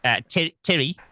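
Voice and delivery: put-on a shaky go at a Kiwi accent. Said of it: "I'm trying to do a Kiwi accent".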